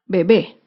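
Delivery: bebé said be-BÉ, landing low on the stressed end; stress on last syllable. Shown as a demonstration. Teacher repeating the example word, last-syllable stress on bebé. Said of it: "Se acentúa en la última sílaba".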